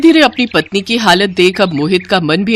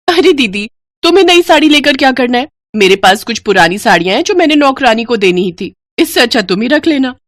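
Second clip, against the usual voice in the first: higher and fast, condescending; fast, higher sneer; mocking younger bride; 5 semitones higher than usual. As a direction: toss off a cruel offer. Younger bride mocks elder.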